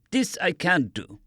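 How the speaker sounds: lightly voiced German doctor, careful and calm; a hint of a calm German accent, slow; understated doctor insisting he is no pirate; captive doctor. A narrator's reading. Doctor answers.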